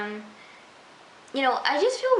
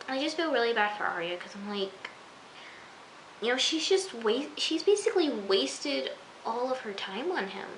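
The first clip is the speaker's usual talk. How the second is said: usual speaking voice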